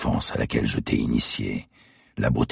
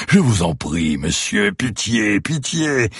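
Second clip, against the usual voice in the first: higher, whiny mock begging; mocking plea; 9 semitones higher, 3 dB louder, slower than usual. Killer mimics the brothers begging, higher mock whine. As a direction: mimic their pleading to sneer. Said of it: "Si tu les avais entendus supplier".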